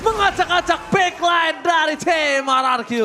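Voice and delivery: loud, high hype play-by-play; fighting game announcer. Acted. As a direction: call the teamfight at full hype. Hype teamfight call.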